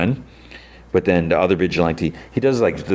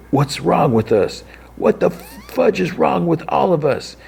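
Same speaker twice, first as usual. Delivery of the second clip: slow, high-pitched mock wail; anguished outburst; 11 semitones higher, slower than usual. [name] thinking back on his brother, slow anguished cry. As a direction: drag it out as an imitated despairing cry.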